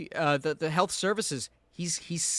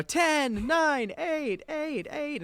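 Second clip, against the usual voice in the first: stuck countdown; 10 semitones higher, much slower than usual. Retold or imitated. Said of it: "and they go".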